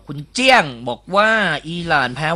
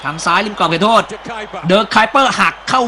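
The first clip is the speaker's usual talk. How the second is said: rising, louder excited match call; live play-by-play; 6 semitones higher, 4 dB louder than usual. Acted. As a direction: call the attack as it builds.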